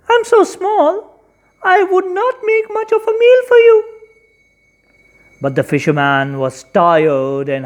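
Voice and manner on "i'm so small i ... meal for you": high, thin little fish voice; small fish voice. Acted. Fish begs the fisherman to let it go, high and small. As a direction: squeak it like a tiny pleading fish. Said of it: "Said the fish".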